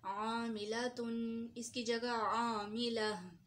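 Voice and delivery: आमिलतुन then आमिला, both stretched out in a recitation tone; teacher recites the word, then its pausal form; slow recitation demo. Shown as a demonstration. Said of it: "मसलन".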